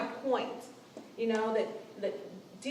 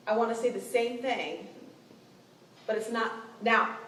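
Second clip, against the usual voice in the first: usual speaking voice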